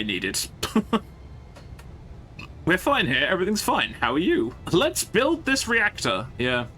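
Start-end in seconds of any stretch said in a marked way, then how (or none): 2.7-6.3 high, breezy mock calm; sing-song denial with higher pitch; mock cheerful. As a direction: pretend all is fine. Mock cheerful after blunder.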